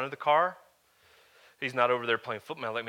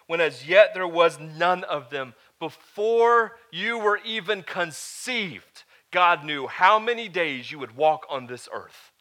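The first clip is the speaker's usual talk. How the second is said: raised, emphatic sermon delivery; building pulpit preaching, louder than the story parts; 4 semitones higher, 5 dB louder than usual. Acted. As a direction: preach it to the room with conviction. Preaching to the congregation.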